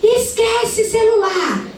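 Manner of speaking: mother yelling at her son over a school note; retold yelling mother. A mother on the balcony yelling at her son, high shrill scolding. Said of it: "E ela gritando com o menino".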